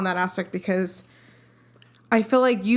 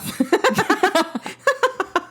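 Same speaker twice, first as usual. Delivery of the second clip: big laugh at a friend; 10 semitones higher than usual. Real, not acted. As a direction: burst of high laughter. Laughing at the lisp remark.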